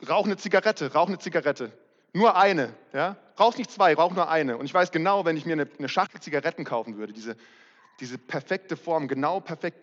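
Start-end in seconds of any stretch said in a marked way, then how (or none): 0.1-4.6 voice of the craving. Retold or imitated. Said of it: "Mein Suchtzentrum ... sagt es".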